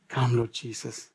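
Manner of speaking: a quiet, longing plea to the Lord; hushed prayer. Acted.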